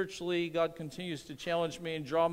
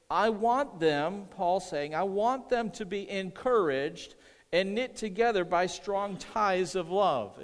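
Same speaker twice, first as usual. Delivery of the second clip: slow, weighty reading of Paul; preacher reading the letter aloud; emphatic scripture reading; 3 semitones higher, 3 dB louder, slower than usual. Acted. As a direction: lean on each word of the verse.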